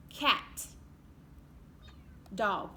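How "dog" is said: ordinary talk, nothing marked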